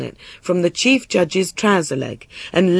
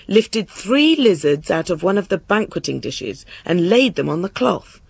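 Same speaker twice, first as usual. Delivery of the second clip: ordinary delivery, nothing marked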